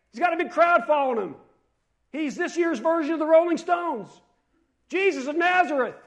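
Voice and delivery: high, loud mock hollering; yelling. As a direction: yell it out like news to a crowd. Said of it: "is that what he yells?".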